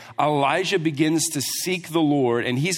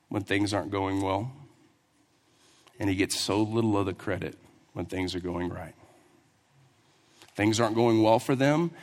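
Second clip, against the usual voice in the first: usual speaking voice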